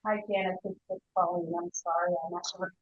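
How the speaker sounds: faint voice over a phone; distant caller on a speakerphone; barely audible. Real, not acted. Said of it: "Barely hear you".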